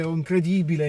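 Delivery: normal talking voice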